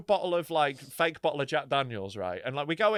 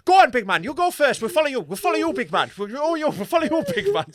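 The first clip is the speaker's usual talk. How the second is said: high, loud, hurried urging; hammed-up nervous Irish voices; mock scared travellers; 8 semitones higher, 7 dB louder than usual. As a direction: push someone else ahead, playing frightened. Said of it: "they're shit scared".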